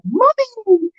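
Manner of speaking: put-on high, squealed child's call of Mommy; toddler's happy "Mommy!". Mum imitating her baby son's squeal: high happy child cry. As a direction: pitch way up like a one-year-old spotting mum. Toddler son greeting mother.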